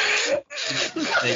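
high squeaky insect noise with laugh; dung beetle impression. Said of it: "your best vocal impression of a dung beetle".

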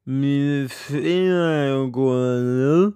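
put-on very slow, drawn-out drunken complaint; mock drunk voice, words stretched long; Chris's drunken mumble. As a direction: drag every word out like a sloshed friend. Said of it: "mumler Chris".

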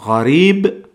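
gharib said alone, the gh from the throat; Arabic pronunciation. Shown as a demonstration. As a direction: model gharib slowly for learners. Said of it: "Vamos a ver primero la palabra gharib".

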